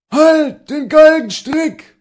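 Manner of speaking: very high, slow, loud mob cry; possessed yelling. A narrator's reading. Mob chases the servant from the yard, possessed yell. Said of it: "als ob sie besessen wären".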